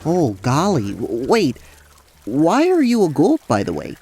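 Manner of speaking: lightly voiced folksy suitor; animated, a little tongue-tied; flustered Rael. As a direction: stumble from bashful flirting into worry.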